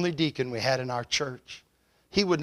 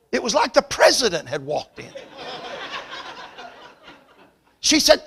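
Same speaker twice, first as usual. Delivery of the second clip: loud, high preacher's delivery; preaching at full force; 7 semitones higher, 8 dB louder than usual. Acted. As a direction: drive the story home from the pulpit.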